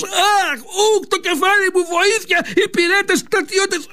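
high, yelping cries for help; king crying in pain. Acted. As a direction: squeal for the guards as you're beaten. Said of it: "να φωνάζει αυτός". (ο βασιλιάς φωνάζει από πόνο, ψηλές τσιρίδες)

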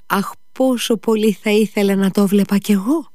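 high, wistful imitation of a boy; quoting a longing wish. A narrator's reading. Kolia repeating his sick friend's wish, high longing voice. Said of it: "και εσύ μου είπες".

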